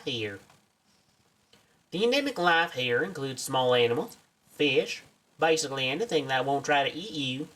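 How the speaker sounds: put-on eager field researcher with a southern accent. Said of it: "I gave you a southern accent".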